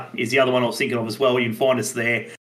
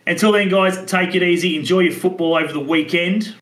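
upbeat host sign-off voice; podcast outro; 5 semitones higher, 4 dB louder than usual. Acted. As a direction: wrap up the show like a host.

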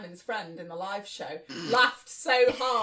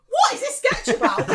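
high, loud outburst through laughter; laughing disbelief; 11 semitones higher, 8 dB louder than usual. Real, not acted. Reader baffled by the sketch, laughing out loud. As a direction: burst out of character, incredulous and laughing. Bewildered reader breaks out of character.

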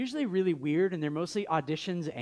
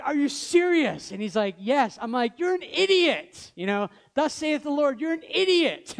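retold anger; 8 semitones higher, 6 dB louder than usual. Pastor yelling at a friend calling off his engagement, angry shout. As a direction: roar it like righteous outrage. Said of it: "I became angry".

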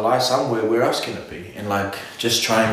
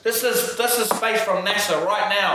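higher-pitched, incredulous mock argument; mock indignant rebuttal; 6 semitones higher than usual. Imitating people rebutting flat-earth talk, mock indignant. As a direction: argue back in disbelief, half in jest.

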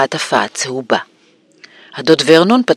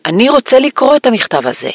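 insistent, much higher-pitched voice; loud demand; 9 semitones higher than usual. A narrator's reading. Son unused to being ignored, high loud demand. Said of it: "אמר בקול חזק".

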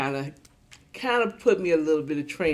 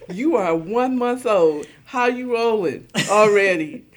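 put-on louder, slow disbelief at the baby; mock astonishment; 5 semitones higher, 8 dB louder than usual. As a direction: marvel at the one-month-old. Talking to baby who rolled.

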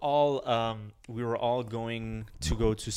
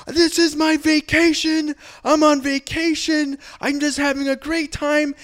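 high, slow, very loud protest; mock indignant yelling; 14 semitones higher, 8 dB louder, slower than usual. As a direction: holler it like a drunk tourist putting on a show. Drunk friend protests to police.

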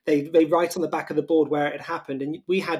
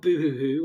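fake crying. Mock sobbing over the breakup.

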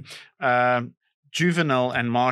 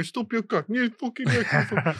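imitating manager; 7 semitones higher than usual. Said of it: "I'm watching these two going".

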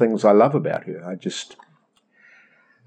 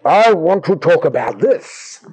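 high, slow, strained outburst; hammed-up demand, dragged out word by word; intense insistence; 5 semitones higher, 3 dB louder, slower than usual. Believer overriding God's topic, mock intense insistence. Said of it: "it builds an intensity".

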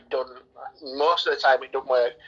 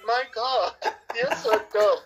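higher voice, laughing through it; half-laughing jab at her; laughing mock insult; 7 semitones higher than usual. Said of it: "And he's like".

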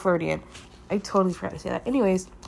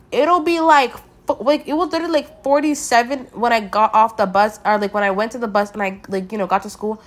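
normal talking voice

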